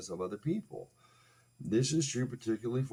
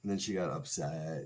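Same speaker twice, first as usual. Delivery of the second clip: ordinary delivery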